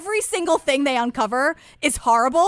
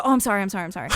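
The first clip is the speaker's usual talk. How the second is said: over-apologetic; 6 semitones lower, 3 dB quieter, much faster than usual. Shown as a demonstration. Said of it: "I just go".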